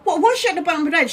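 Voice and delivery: high, fast, startled question; a nurse suddenly spotting a problem; alarmed nurse. Retold or imitated. Nurse finding the mum at the bed's foot, high and fast alarm.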